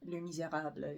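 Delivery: Les Misérables in a French accent; rough stab at a French accent. Shown as a demonstration. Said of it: "say it with, uh, a French accent".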